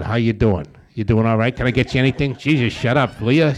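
loud, nagging bedside chatter; mock fussy visitor. Friend at the hospital bed, loud nagging chatter. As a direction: pester the patient loudly, then snap.

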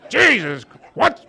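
high, loud exasperated outburst; mock outrage. Members at the meeting reacting to the mistake, loud mock outrage. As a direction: blurt it out scandalized.

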